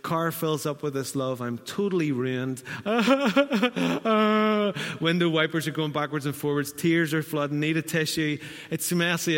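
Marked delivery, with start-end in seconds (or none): 2.7-5.0 a loud high burst of laughter; real laugh. Real, not acted. Laughing at own messy story.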